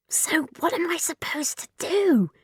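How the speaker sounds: sharp unvoiced whisper; hissed. Girl hissing at her friend beside the robot, urgent whisper. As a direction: hiss it at him through the fear. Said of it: "she hissed at Darren".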